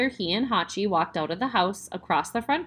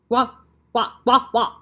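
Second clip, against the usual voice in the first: put-on louder yips like a young puppy; puppy yipping; 3 semitones higher, 7 dB louder than usual. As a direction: yip the way a little puppy does. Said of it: "yipping like a young puppy".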